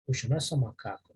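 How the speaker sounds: ordinary delivery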